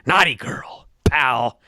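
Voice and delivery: lightly voiced creepy leer, ending in a whisper; a hint of a leering old boss, last word whispered; creepy Vince. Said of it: "Vince gets all creepy".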